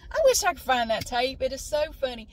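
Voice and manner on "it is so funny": usual speaking voice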